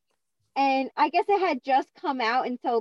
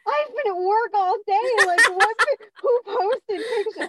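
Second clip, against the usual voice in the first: high loud panicked questions; frantic confusion, voice up high; 8 semitones higher, 5 dB louder than usual. Retold or imitated. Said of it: "and then I was like".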